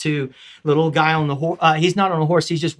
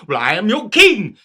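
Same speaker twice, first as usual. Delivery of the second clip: King Arthur; 5 semitones higher, 7 dB louder than usual. Acted. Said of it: "King Arthur".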